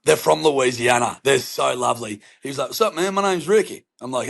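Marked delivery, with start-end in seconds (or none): 2.7-3.9 lightly voiced friendly neighbor, pitched up. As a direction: imitate an upbeat, high-pitched new acquaintance. Acting the friendly American neighbor.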